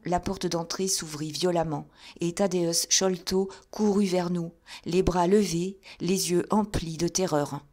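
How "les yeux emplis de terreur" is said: ordinary delivery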